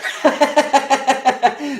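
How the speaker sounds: high cackling laugh at his own jab; gleeful high laugh. Real, not acted. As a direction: cackle at the friend's expense. Fran Drescher joke about friend.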